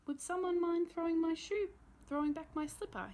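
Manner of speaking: a reformed grump, suddenly playful; eager old man. Acted. Mister Wintergarten asking the kids for his slipper, eager and high.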